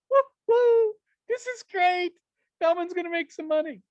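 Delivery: high, loud whooping cheer, hammed up; mock audience cheer. Speaker mocking the audience's imagined cheer, high and whooping. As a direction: cheer like the crowd smelling money. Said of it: "some of you are going".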